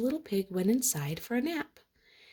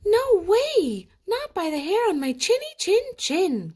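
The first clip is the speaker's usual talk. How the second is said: high, loud piggy shout; second pig shouting at the wolf; defiant cry; 14 semitones higher, 8 dB louder than usual. Acted. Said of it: "cried the second little pig".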